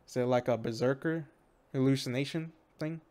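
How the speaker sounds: usual speaking voice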